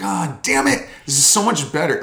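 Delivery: comic annoyed outburst; hammed-up exasperated reaction; mock exasperation. Retold reaction to another onlooker arriving.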